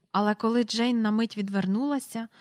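plain reading voice

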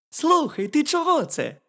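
high-pitched little hedgehog; jeżyk. A narrator's reading. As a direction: ask as a puzzled hedgehog, high and loud. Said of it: "запитав їжачок".

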